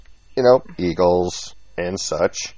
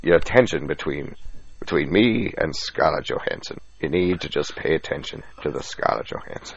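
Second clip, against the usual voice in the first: low, halting explanatory impression; Morgan Freeman; 5 semitones lower, 4 dB quieter than usual. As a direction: explain it patiently, dropping low and quiet. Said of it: "Morgan Freeman sits down with you and explains".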